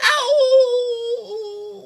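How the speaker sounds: high loud playful howl; dad howls for his kids to copy; wolf howl. Pretend. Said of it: "Can you guys howl?".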